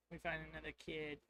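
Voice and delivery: usual speaking voice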